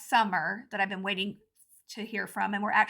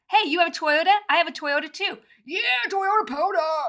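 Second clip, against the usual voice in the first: mock excited stranger; 6 semitones higher, 6 dB louder than usual.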